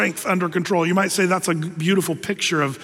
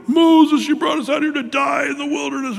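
accusing shout at Moses from the people; crowd complaining; 7 semitones higher than usual. Retold or imitated. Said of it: "The people were".

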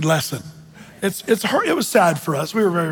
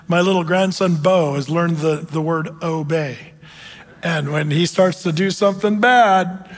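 ordinary talk, nothing marked

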